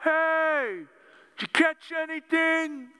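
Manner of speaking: calling out. Retold or imitated. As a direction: call out to the boat from shore. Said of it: "guy on shore who calls out".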